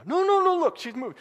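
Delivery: high, loud, desperate insisting; frantic pleading. Retold or imitated. Boy insists to the driver his dog lives, frantic. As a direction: beg them to see she's alive. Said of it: "You know".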